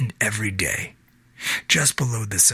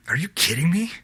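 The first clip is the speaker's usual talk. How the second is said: rising, higher, indignant question; angry disbelief; 6 semitones higher than usual. A narrator's reading. Wife on the phone to her husband, angry disbelief. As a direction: hit her outrage at the end of the question. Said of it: "Now it was anger".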